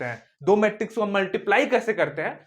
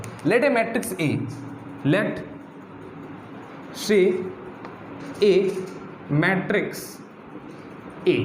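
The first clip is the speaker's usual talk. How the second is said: very slow, with long pauses between words; drawn-out teacher dictation; much slower than usual. Shown as a demonstration. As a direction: dictate it so they can copy. Dictating an example slowly.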